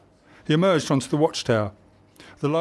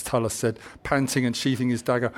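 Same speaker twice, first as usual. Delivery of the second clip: usual speaking voice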